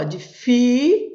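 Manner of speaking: teacher stretching the strong syllable of "de fi"; stressed syllable held. Shown as a demonstration. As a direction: stop on a long, strong "fi". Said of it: "sílaba forte".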